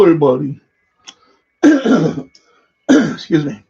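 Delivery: normal talking voice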